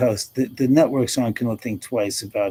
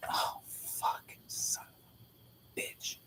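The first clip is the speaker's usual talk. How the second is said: real whispered dismay; whispered cursing; 18 dB quieter, slower than usual. Real, not acted. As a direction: curse under your breath, all whisper. Cursing own spelling mistake.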